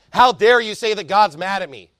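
normal talking voice

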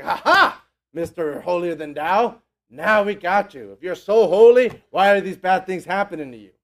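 loud, taunting mock triumph; envious gloating. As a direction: gloat like a jealous friend catching him out. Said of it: "They're just envious".

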